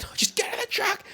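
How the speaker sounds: high-pitched imitation of an exasperated order; mock yell. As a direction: yell it over her explanations. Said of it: "Just yells at this woman".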